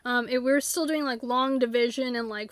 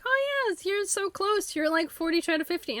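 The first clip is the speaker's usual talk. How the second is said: much higher, bright hype voice; cheery encouragement; 6 semitones higher than usual. Pretend. Cheering a friend on at the gnome challenge, high and excited. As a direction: hype them up like they're almost there.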